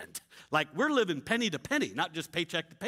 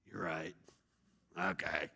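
sheepish give-in; 13 semitones lower, 9 dB quieter than usual. Retold or imitated. Sheepish surrender to God.

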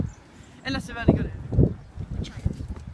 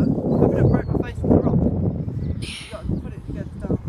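windy action camera; 8 semitones higher than usual. Real, not acted. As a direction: wind roaring over the mic. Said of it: "Sorry about the wind".

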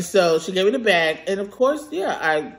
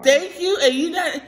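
mock pointed thanks; 10 semitones higher than usual. Retells her own pointed thanks.

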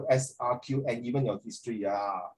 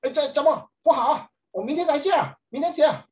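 put-on voices a flustered girl, pitched high and loud; a squeaky put-on protest, louder and much higher; high mock whiny voice; 12 semitones higher, 4 dB louder than usual. Teacher mimics a flustered student, high and loud.

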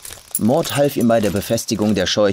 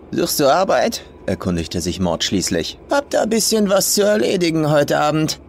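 put-on rough old farm woman; 6 semitones higher than usual. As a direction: sneer it as the crude old farmer.

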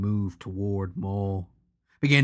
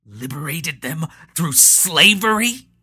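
spat back; 6 semitones higher, 8 dB louder than usual. Acted. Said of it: "Patro spat back".

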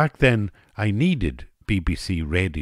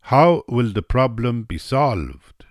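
high, loud, slow question; earnest Russian woman asking; Russian hostess; 5 semitones higher, 5 dB louder, slower than usual. A narrator's reading. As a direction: pose it slowly with raised voice. Russian woman quoted in story.

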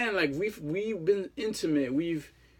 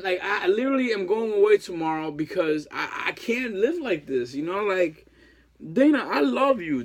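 ordinary talk

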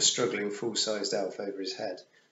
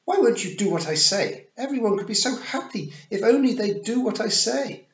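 high, loud, shrieking tantrum; furious Queen; 13 semitones higher, 8 dB louder than usual. A narrator's reading. The Queen screams at Tiffany in a loud high tantrum. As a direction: scream it like a thwarted child. Queen loses her temper.

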